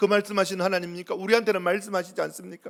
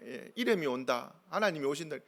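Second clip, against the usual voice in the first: ordinary talk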